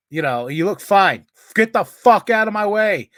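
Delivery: hammed-up irate shout; comic angry outburst. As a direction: blow up at the queue-jumper. Angry retort, story punchline.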